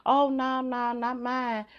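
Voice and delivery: slow, drawn-out denial; smug brush-off of the idea; mock defensive parent. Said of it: "some of y'all like".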